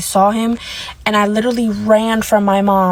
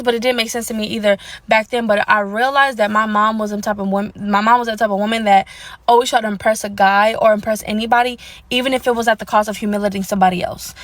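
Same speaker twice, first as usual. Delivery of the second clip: ordinary delivery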